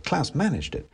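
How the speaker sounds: ordinary delivery, nothing marked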